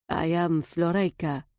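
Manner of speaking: lightly voiced Eastern European accent. A narrator's reading. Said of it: "in a thick Eastern European accent".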